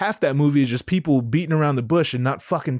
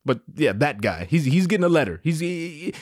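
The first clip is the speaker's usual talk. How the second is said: ordinary delivery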